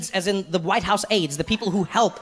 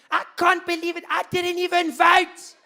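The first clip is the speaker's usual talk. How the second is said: put-on higher, slow, aghast South African accent; panicked South African; 10 semitones higher, slower than usual. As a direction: wail in South African disbelief. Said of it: "People lost their minds even worse".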